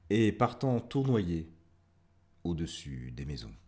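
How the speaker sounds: measured poem recital with long pauses; poetry reading. Acted. Host reading listeners a poem about a dandelion, measured poetry recital. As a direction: read verse line by line, lingering on phrases.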